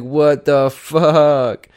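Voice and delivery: slow, high, loud mock disbelief; shocked reaction. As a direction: stretch out the shocked question. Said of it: "he was like".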